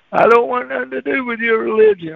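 acted angry homeowner, pitched high; high-pitched mock indignation; angry refusal. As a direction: send him off the porch indignantly. Angry homeowner rejects him.

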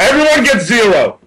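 high, loud decree; tyrant overlord voice. Pretend. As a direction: bellow it like a mad king. Mock tyrant seizing the show.

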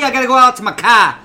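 loud, high mock Bostonian; broad Boston "cah" with the R dropped; hammed-up Boston local; Boston accent. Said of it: "I was gonna say Boston".

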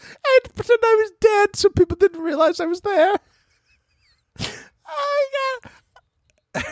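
high, breathless laughing talk; laughing through words. Real, not acted. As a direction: squeal it out through helpless laughter. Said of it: "laughing while telling".

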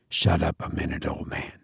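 hushed, low aside. A narrator's reading. Will hushes his companion, low voice. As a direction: keep it down, for one ear only. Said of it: "he said in a low voice".

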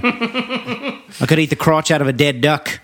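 slow, laughing Aussie voice; lightly voiced Australian phrase, slow; Australian accent. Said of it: "and it was".

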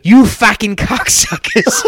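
high, loud Aussie heckling yell; mock Aussie abuse at the players; loose Aussie accent. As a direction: scream it like a drunk Australian fan. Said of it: "That was my loose Aussie accent there".

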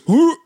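sudden high yelp; high whoop. Retold or imitated. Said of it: "And he goes".

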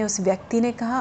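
ordinary delivery

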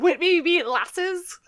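high, slow mock Scottish; attempted Scottish accent. Co-host asks Rick about lasses, high mock Scottish. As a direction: ask it slowly in playful Scottish.

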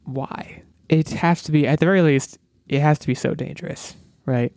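normal talking voice

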